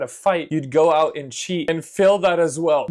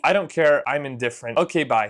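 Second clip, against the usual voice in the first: ordinary delivery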